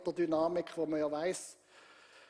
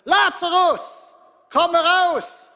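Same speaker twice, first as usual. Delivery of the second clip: booming, high, slow cry; loud call; 11 semitones higher, 16 dB louder, slower than usual. Acted. Jesus at the tomb calling Lazarus, slow booming shout. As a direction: call him out of the grave at full voice. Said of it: "mit lauter Stimme gerufen".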